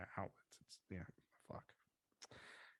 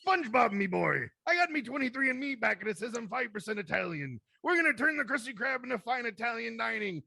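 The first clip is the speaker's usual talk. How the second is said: high, gruff pirate-crab voice; Mister Krabs voice; 8 semitones higher than usual. Pretend. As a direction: growl it like a greedy sea captain. Mr. Krabs impression after praise.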